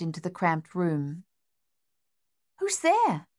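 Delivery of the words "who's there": put-on higher young woman's question; Lisette's voice. A narrator's reading. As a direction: ask nervously at the door. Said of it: "Lisette asked".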